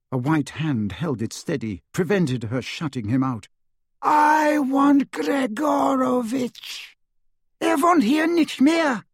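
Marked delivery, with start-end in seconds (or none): 4.0-7.0 very high, slow menacing demand; high, cold voice. A narrator's reading. Dark wizard demanding a man from a woman, high and cold. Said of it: "said a high, cold voice". 7.6-9.0 high-pitched, louder panicked woman; frightened cry. A narrator's reading. Woman turning away a threatening stranger, crying out high. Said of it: "she cried".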